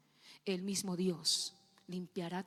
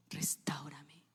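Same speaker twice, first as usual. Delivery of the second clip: whispered prayer; 6 semitones lower, 5 dB quieter than usual. Acted. David's prayer voiced.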